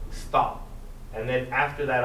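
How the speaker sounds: ordinary delivery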